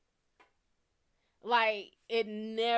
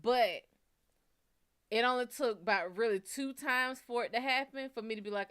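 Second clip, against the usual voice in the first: usual speaking voice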